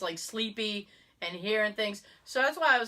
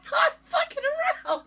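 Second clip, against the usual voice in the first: high laughing insistence; 4 dB louder, faster than usual. Real, not acted. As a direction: swear it while laughing. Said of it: "I swear".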